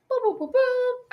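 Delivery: high, slow playful boop sounds. Pretend. Host teasing co-hosts before her rant, with high slow boops.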